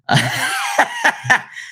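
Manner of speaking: breathless high-pitched laugh; high airy laugh. Real, not acted. Reacting to Jay Waller's post with a high breathy laugh. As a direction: let a high silent laugh burst out. Cracking up at the posts.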